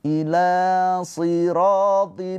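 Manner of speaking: slow melodic tajwid chanting; Quranic recitation. Acted. Teacher chants the verse for students, slow and melodic.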